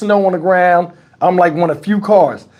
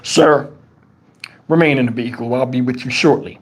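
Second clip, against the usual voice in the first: low, measured imitation of an officer; official cop voice; 8 semitones lower than usual. Officer to impatient driver leaning out, official cop voice. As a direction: act out a formal police instruction, pitched down.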